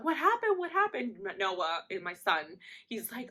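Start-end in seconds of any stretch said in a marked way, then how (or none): 0.0-1.2 high, urgent repeated question; imitated alarmed mom. Mom wanting to know what happened, high urgent imitation. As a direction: pester urgently in a raised high voice.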